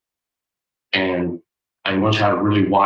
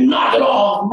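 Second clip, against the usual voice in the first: high angry shout; angry boy shouting; 11 semitones higher, slower than usual. Retold or imitated. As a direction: yell it at the echo. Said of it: "Now he's angry".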